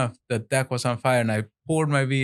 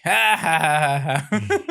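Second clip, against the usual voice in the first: loud mock belly laugh; high, hammed-up cackle; imitated laughing; 4 semitones higher, 4 dB louder than usual. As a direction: imitate a neighbor bursting out laughing. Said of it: "he just starts laughing".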